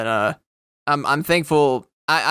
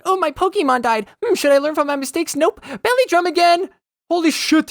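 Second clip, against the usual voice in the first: high mocking gamer voice; 14 semitones higher, 3 dB louder than usual. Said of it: "And then".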